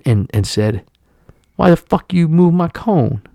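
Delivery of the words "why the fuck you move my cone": higher-pitched muttered cone complaint, badly impersonated; bad toothless-man impression. Older delivery man challenging the driver, terrible impression. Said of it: "that was a terrible impression".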